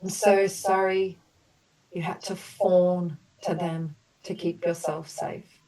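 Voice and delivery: gentle, measured apology statements for the group; compassionate healing affirmations. Acted. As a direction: offer each sorry like a soothing balm. Leads group through healing apologies.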